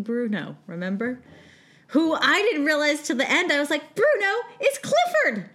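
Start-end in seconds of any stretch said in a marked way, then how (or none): 3.9-5.5 high-pitched, re-enacted shock; astonished realization. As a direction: blurt it out in mock disbelief. Acting out own astonished realization.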